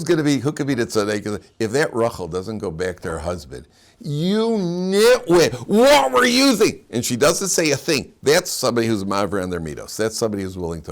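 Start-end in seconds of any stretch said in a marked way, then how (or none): 4.0-6.9 high, loud, drawn-out mock yell; hammed-up wife's tirade; exasperated scolding. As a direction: lay into a foolish husband, slowly. Wife scolding her husband, acted out.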